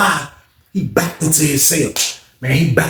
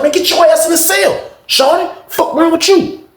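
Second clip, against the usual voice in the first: hammed-up angry inmate; angry shout; 13 semitones higher than usual. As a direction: bark at him to get in. Inmate shoving Coach into cell.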